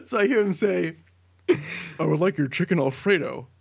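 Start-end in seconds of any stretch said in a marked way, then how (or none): 1.5-3.5 lightly imitated big-voiced customer ordering; a hint of a deep, booming man; Barack Obama, deep carrying voice. Teller imitating Obama ordering across the room, deep carrying voice. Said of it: "You can hear this guy's voice".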